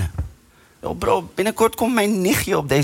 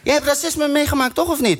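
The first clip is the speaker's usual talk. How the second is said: higher, urgent explaining; animated explaining; 9 semitones higher than usual. Retold or imitated. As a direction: press the point home. Lecturing the guy, higher.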